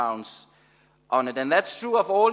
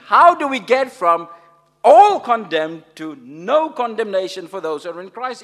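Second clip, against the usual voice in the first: higher and much louder, building question; louder, rising preacher's challenge; excited; 5 semitones higher, 11 dB louder than usual. Real, not acted. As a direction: press the puzzle on the congregation with fire.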